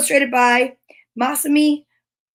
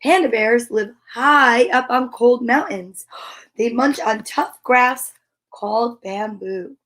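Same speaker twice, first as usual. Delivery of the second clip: put-on reading to children. Teacher reads the bear book aloud to young kids. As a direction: read it to little ones with wonder.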